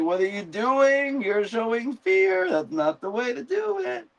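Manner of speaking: high mock scolding voice; a high squeaky scold for showing fear; exasperated guard. A guard scolding Simon for showing fear, in a high squeaky mock voice.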